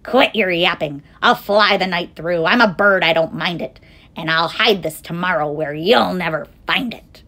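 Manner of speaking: loud, dismissive bird; eagle calling back. A narrator's reading. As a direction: toss it loudly over the shoulder. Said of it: "over his shoulder called back".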